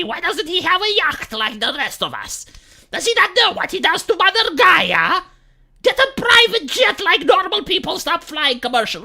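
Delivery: hammed-up scolding celebrity voice; mock preachy celebrity. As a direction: lecture him like a smug millionaire. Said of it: "lecture my lifestyle".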